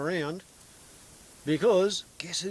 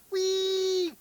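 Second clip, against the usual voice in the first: gleeful whee; 3 dB louder than usual. Retold or imitated. Mock Abbott cheer.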